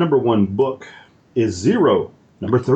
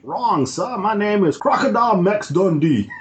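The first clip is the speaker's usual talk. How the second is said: high, badly faked Aussie; hammed-up Australian outback introduction; terrible Australian accent; 5 semitones higher than usual. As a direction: do a bad Crocodile Dundee. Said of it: "That's pretty terrible".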